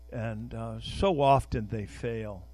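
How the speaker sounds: normal talking voice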